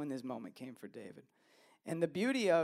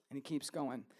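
ordinary talk

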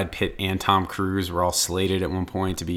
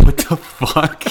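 "What the fuck" drawn out slowly, louder and higher; stunned, half-laughing disbelief at what he just heard; baffled outburst; 8 semitones higher, 7 dB louder, much slower than usual. Retold or imitated. Baffled by friend's accent.

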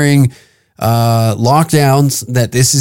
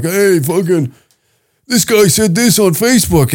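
much higher, whiny tattling voice; mock complaining caller; 6 semitones higher than usual. Caller reporting a comic to the club, whiny. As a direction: tattle like a busybody on the phone.

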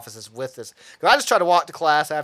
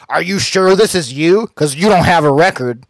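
loud, suspicious cop voice; loud mock cop; 3 semitones higher, 12 dB louder than usual. Cop checking the ID, loud and suspicious. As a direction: bark it like a doubting cop. Cops quoted doubting the ID.

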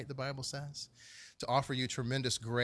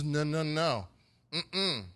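higher-pitched, insistent refusal; protesting Peter; 3 semitones higher, 5 dB louder than usual. Acted. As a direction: act out a stubborn disciple objecting. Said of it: "Peter talks like this".

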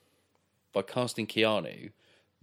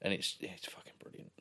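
an awed hush, no voice; whispered; 10 dB quieter than usual. Real, not acted. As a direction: breathe it out in admiration. Hushed praise of the scene.

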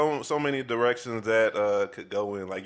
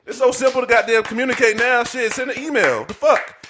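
loud, high exasperated outburst; exasperated rant; 8 semitones higher, 6 dB louder, slower than usual. Retold or imitated. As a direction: rant it out, fed up. Venting frustration, acted exasperation.

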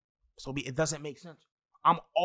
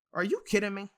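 incredulous; 6 semitones higher, 4 dB louder than usual. Real, not acted. Repeated disbelief at the contradiction.

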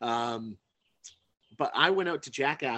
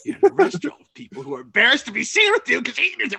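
loud, high mock tirade; imitated outburst, much louder and higher; exasperated scolding; 11 semitones higher, 13 dB louder, faster than usual. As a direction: blow up like a mortified friend. Embarrassed friend scolds diner in restaurant.

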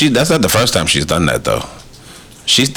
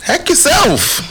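exasperated scolding. Retold or imitated. Mock addressing the hackers.

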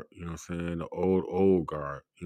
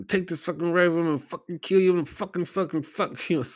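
high, loud overdone cursing parody; mock TV-show dialogue; 10 semitones higher, 3 dB louder than usual. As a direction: ham up a badly written TV character. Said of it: "nobody talks like that".

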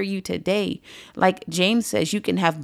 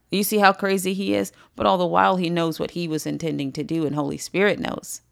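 ordinary talk

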